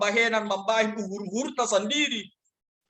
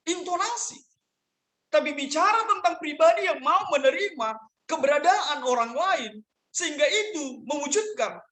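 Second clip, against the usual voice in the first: raised, high-pitched sermon voice; preaching; 10 semitones higher than usual. Acted. Pastor restating the lesson to parents and youth, preaching. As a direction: return to the main point with pulpit emphasis. Preacher returns to main point.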